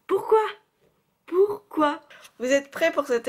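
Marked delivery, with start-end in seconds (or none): 0.1-0.4 high, loud squeal of disbelief; exasperated why. Real, not acted. Outraged at Ron's lisped voice. 1.3-2.0 falling, resigned question; dismayed why. Real, not acted. Repeats the outraged question.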